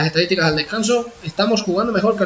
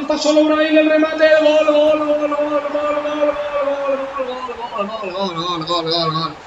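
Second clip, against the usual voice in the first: breakneck goal commentary; 6 semitones higher, slower than usual. Real, not acted. Header goal scored.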